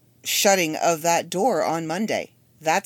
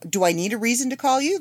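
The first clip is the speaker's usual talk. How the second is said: breezy mom; 8 semitones higher than usual. Retold or imitated. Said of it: "I'm like".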